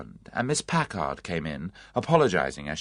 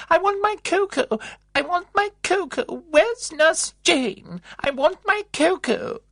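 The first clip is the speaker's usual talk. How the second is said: very high, loud fretful demand; loud shrill old woman; 5 dB louder than usual. A narrator's reading. Old resident calling down the passage for cocoa, shrill and loud. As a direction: cry out for it like a fussy old lady. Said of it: "calling in a loud, shrill voice".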